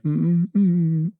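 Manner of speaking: mock hesitant humming, slow; mock nervous mm mm mm; imitated hums. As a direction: echo the odd little hums back, drawn out. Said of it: "You did some weird stuff right there".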